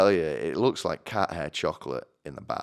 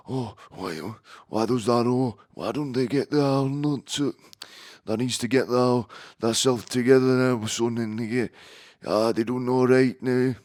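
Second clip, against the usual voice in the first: hammed-up, higher-pitched broad Yorkshire accent; 4 semitones higher than usual. Apologising for not sounding like Sean Bean, mock broad Yorkshire accent. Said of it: "I'll put on a, a deep, broad York-, Yorkshire accent".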